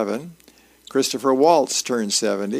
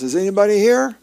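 raised, higher call through the house; acted yell to see who's around; 8 semitones higher, 7 dB louder, faster than usual. A narrator's reading. Husband finds his wife not there, yelling out. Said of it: "and he yells".

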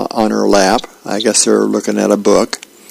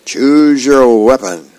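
duel challenge accepted; 3 semitones higher, 4 dB louder, slower than usual. A narrator's reading.

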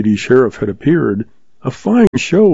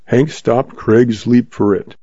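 usual speaking voice

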